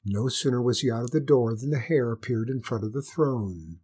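ordinary delivery, nothing marked

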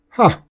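sharp high bark of a laugh; a quick triumphant ha; mock gleeful ha; gloating ha. A narrator's reading. Death gloats at old man.

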